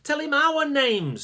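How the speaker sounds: high, loud eager urging; excitedly. Acted. Sasha urging Max to introduce them, excited. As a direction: push him on, eager. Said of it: "said Sasha excitedly".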